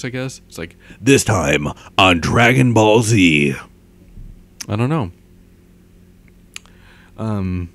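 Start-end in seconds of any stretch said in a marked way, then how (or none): 1.0-3.5 loud, slow announcer; trailer voice. Shown as a demonstration. Announcer introducing the next episode, booming. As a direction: boom it out like a trailer narrator. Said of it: "the trailer voice".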